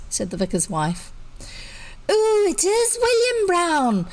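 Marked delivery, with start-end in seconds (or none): 2.1-4.1 put-on high, loud insisting little girl; shrill it stubbornly like a small girl. A narrator's reading. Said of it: "persisted the little girl shrilly".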